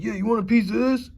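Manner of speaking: mock tough guy. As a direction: puff up like a wannabe brawler. Mocking the challenger again.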